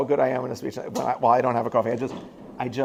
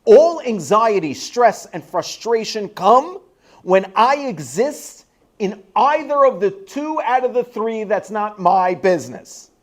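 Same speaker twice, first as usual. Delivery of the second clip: impassioned sermon delivery, slow and emphatic; rising pulpit intensity; preaching; 8 semitones higher, 5 dB louder, slower than usual. Acted. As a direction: drive each point home to the congregation. Preaching with force.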